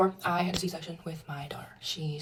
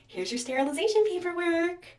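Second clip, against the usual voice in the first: mock nurse voice; 10 semitones higher, 6 dB louder than usual. Nurse voiced in retold story.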